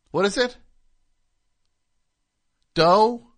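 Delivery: high rising feigned puzzlement; mock confusion. Host to listeners, feigning he forgot the Doughboys name. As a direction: pretend to grope for the name.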